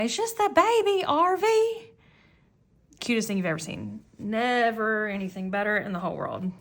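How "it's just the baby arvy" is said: put-on much higher, louder little-kid call across the cart; imitating toddler yelling. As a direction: call out like an excited three-year-old. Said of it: "he's yelling ... and he's going".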